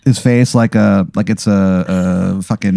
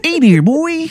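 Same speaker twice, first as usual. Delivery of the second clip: much higher Baltimore accent; terrible Maryland accent. Shown as a demonstration. Game master demos the accent to the player, Maryland accent. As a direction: show off the awful Maryland accent. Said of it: "It's a terrible accent".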